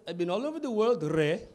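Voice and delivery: imitated Indian accent, dropping low at the end. Comic voices an Indian man at Mister Greek, mock Indian accent. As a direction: play the Indian restaurant worker. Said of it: "Indian people in Mister Greek".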